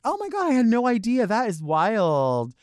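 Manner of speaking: high start, then drawn-out mock wonder; laughing it off politely; fake amused amazement. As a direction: gush insincerely, stretching the words. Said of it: "I'll laugh it off for a second".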